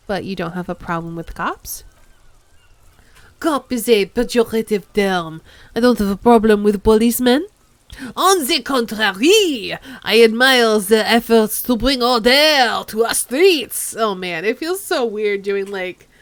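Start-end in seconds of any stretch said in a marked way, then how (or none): 3.4-14.0 bad French accent. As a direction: play the proud French old man. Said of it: "over-the-top bad French accent".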